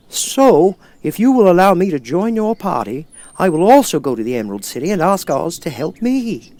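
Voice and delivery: a rusted man grateful to be heard; Tin Man. Acted.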